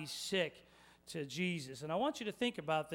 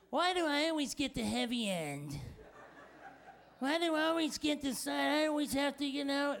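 higher, petulant griping about the heavy end; whiny complainer; 5 semitones higher than usual. Retold or imitated. A stretcher-bearer complaining to the others, in a higher whiny voice. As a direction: whine it like a put-upon helper stuck with the load. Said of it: "He drops aside and he says".

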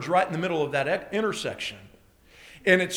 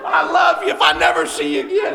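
raised, overwrought goodbye; loud, high mock-desperate last words; frantic farewell; 6 semitones higher, 4 dB louder than usual. Shaken husband phones wife after near crash, frantic farewell.

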